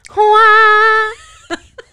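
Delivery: high, loud, long ghostly wail. Pretend. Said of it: "You do a spooky noise".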